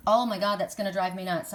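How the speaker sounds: ordinary delivery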